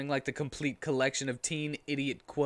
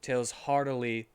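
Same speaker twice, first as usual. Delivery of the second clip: plain reading voice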